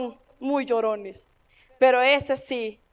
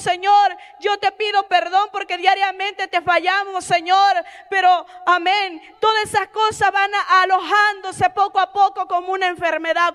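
higher pitch, building to a shout on "Señor"; impassioned preaching; 6 semitones higher than usual. Acted. Preacher leading the church in confession, raised and fervent. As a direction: cry it out to the Lord.

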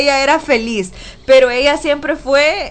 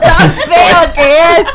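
laughing through the quote, slow and loud; teasing laugh on tan feo; laughing tease; 5 semitones higher, 7 dB louder, slower than usual. Retold or imitated. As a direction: giggle out the punchline. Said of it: "le decía".

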